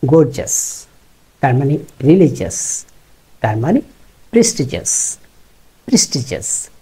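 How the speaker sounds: -gious said as "jus"; গর্জিয়াস as "gorjas"; প্রেস্টিজিয়াস with "jas", no "ee-as"; jus ending. Shown as a demonstration. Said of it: "সবকটা জাস".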